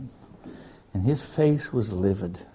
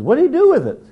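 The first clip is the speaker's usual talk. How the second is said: high, squeaky mock disbelief; incredulous high voice; 9 dB louder, faster than usual. Preacher voicing a scoffing pilot, squeaky and incredulous. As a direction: pitch it way up like a scoffing old friend. Doc's friend mocking prayer book.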